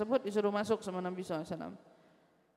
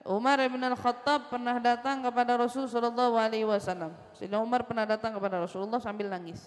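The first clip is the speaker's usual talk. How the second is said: ordinary talk, nothing marked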